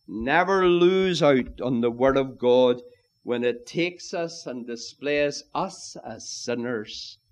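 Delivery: usual speaking voice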